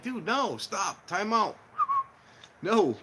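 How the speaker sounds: high alarmed stop; telling friend off. Retold or imitated. Warning friend against wiring money, alarmed. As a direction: halt him urgently. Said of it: "I'm like".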